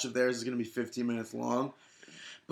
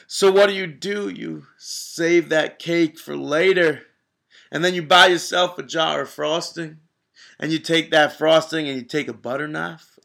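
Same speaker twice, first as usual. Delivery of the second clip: loud wrestling promo; 4 semitones higher, 7 dB louder than usual. Pretend. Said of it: "cut a little promo for us real quick".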